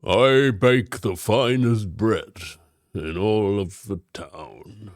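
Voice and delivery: slow, chin-out boastful baker voice; proud baker. Acted. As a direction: brag about your bread like the town's proud baker. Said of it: "and I'm leading with my chin".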